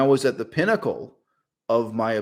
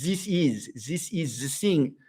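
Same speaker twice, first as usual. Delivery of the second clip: imitating a French accent, "this is" said as "zis is"; French accent with "thing" said as "sing"; 3 semitones higher, 4 dB quieter, slower than usual. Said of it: "there is no reason for French people to say "this is" as".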